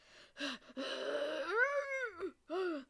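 long, loud breath out rising high; big exhale to pull yourself together; composing sigh. Real, not acted. Podcaster collecting herself after choking up, loud long sigh. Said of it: "Let me collect myself real quick".

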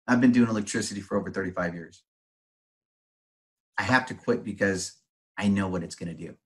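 friend's words dropped to a soft, grave hush; hushed retelling. Retold or imitated. Electrician friend explaining why he quit, hushed and grave.

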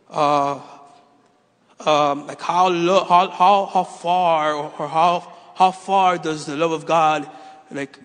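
halting, stretched-out improvised question; fumbling for words. Retold or imitated. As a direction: stall and stammer as you invent a question. Fumbles for a made-up question, retold.

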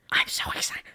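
hushed, thrilled whisper; excited whisper. Real, not acted. Fan whispers her excitement about the Las Vegas concert. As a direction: whisper it, barely containing yourself.

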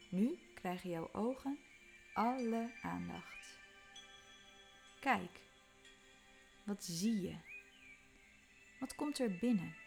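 normal talking voice

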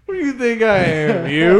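cocky dad. Retold or imitated. Dad mocking his son's driving, loud cocky jab. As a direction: throw a cocky jab at your son. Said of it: "He's like".

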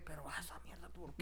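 low, hushed imitation of a skeptic; muted mock disbelief. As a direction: imitate a doubter almost under the breath. Imita la reacción incrédula de la gente. (incredulidad imitada en voz muy baja)

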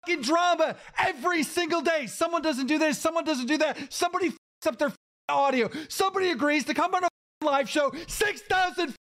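high-pitched, exasperated tirade; worked-up shouting rant, frustrated; angry rant. Real, not acted. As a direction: reel off grievances, pitch climbing high. Rant continues about daily drama.

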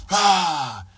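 short loud high yelp; a startled yelp, thrown out loud; mock yell. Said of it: "And I'd be like".

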